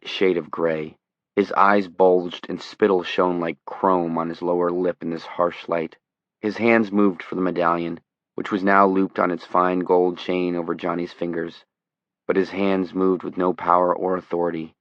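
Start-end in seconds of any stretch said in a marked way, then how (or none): none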